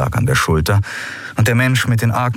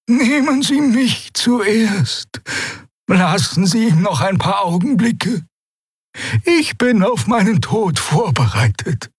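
calm yet pleading sacrifice; pleading old woman; 11 semitones higher than usual. Acted. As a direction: beg to be shot first. Xea begging to die first.

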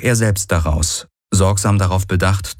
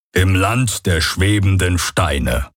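Hox's slow voice; slower than usual. Acted. Hox greets them grandly.

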